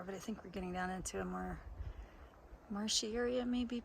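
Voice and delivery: ordinary talk, nothing marked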